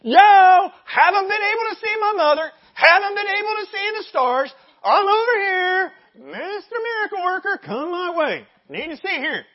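high, loud hollering for attention; demanding shout. Acted. Blind man demanding the miracle worker come over, brash shout. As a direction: holler across a crowd like you are owed a favour. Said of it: "rather than saying".

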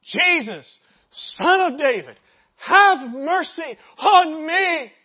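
loud, drawn-out plea; persistent shout. Acted. Blind man shouting on despite the rebukes, persistent plea. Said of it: "he continued to shout out".

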